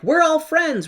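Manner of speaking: high eager declaration; eager friends. Retold or imitated. The friends rallying around Dorothy, high and eager. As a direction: blurt it out high and keen. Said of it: "they're like".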